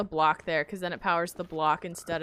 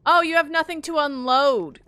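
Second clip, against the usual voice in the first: excited realization; 7 semitones higher, 8 dB louder than usual. Real, not acted.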